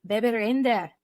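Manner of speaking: a bad, faint Scottish accent on "where I will be covering Baby Reindeer"; atrocious Scottish accent. Nodding to Scottish listeners with a bad Scottish accent. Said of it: "how atrocious that accent was".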